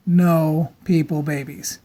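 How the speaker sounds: ordinary delivery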